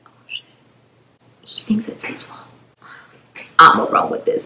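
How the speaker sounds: barely audible whisper to yourself; hushed, acted inner thought; scheming aside. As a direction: whisper it like a secret realization.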